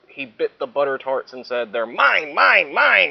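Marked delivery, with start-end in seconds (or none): 1.7-3.1 louder, rising grab of mine; snatch the food, claiming; greedy dinosaur. Acted. Dinosaur grabbing snacks, louder claim.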